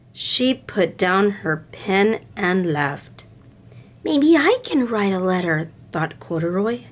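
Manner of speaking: gentle storybook read-aloud with voices; storybook reading for little girls; reading to children. A narrator's reading. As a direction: read it like bedtime story time. Reads picture book to girls.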